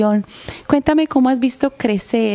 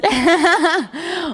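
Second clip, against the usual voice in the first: bright burst of laughter; 9 semitones higher than usual. Real, not acted. Host laughing about portuñol.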